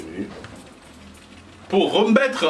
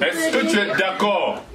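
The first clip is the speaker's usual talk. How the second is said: higher, slow, projected question; raised voice, word by word; speaking up; 3 semitones higher, slower than usual. Real, not acted. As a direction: ask it slowly so the whole room hears. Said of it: "Plus fort".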